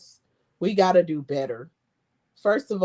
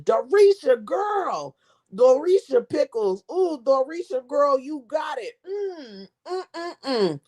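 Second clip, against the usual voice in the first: food taster catchphrase; 5 semitones higher, slower than usual. Pretend. Vlogger mimicking a food taster's praise, lively sing-song hype. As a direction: cheer the name like a reviewer loving the pickles. Hyping up her own pickles.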